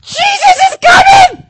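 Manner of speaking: slow, very high building shout; excited shout. Acted. Bartimaeus, like Buddy, screams that Jesus is coming. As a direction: build to a yell of joy. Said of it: "blind Bartimaeus".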